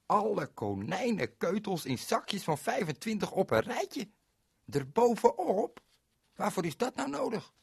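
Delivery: high, baffled disbelief; a high voice, puzzled by the odd request; incredulous. Retold or imitated. Ko asks the radio man, high and baffled.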